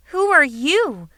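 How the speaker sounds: loud, high worried question; alarmed, calling out; concerned. Acted. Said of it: "asked in concern".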